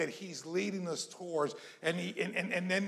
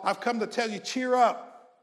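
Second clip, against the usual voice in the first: high, raised preacher's voice; rally them with loud, high exhortation; rousing preaching; 6 semitones higher, 4 dB louder than usual. Real, not acted. Preacher urging a discouraged congregation to cheer up, rousing preaching.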